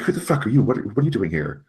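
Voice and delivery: high-pitched startled demand; panicked delivery guy. Acted. Delivery guy spotting Ellen in the brush, startled and high. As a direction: blurt it out in a high, alarmed voice.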